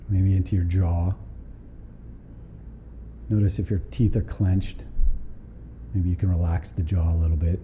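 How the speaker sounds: calm body-scan guidance with long pauses; guided meditation. Real, not acted. Teacher guiding a group through a body scan, calm meditation voice. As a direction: lead them unhurriedly down through the body, leaving space between phrases.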